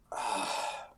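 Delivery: long soft exhaled sigh; voiceless sigh of a worn-out trudger; weary sigh. Acted. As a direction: let out a tired, breath-only groan. Weary trudger sighs on reaching town.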